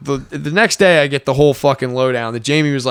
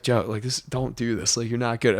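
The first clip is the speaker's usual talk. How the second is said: quiet warning; 4 semitones lower, 10 dB quieter than usual. Retold or imitated. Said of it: "James just was like".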